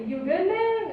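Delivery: high, breezy question from a passing stranger; a cyclist rolling past, asking casually; casual passerby. Retold or imitated.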